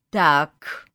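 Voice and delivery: loud, single indignant burst. A narrator's reading. Woman pushing past mother to kitchen, indignant. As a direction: cut in with firm outrage. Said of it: "возмутилась я".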